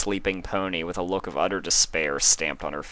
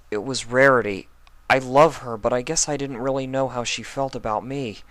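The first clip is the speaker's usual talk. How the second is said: louder, higher, tearful confession; choking back tears; 7 semitones higher, 4 dB louder than usual. Acted. Spike confessing his love for Rarity, choking back tears. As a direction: fight the tears as you admit it. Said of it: "choking back fresh tears".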